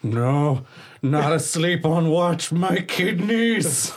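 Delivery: loud, rising, drawn-out yelp; panicked waking cry. Acted. Sleeper jolting upright on watch, panicked cry. Solinar jolts awake in panic.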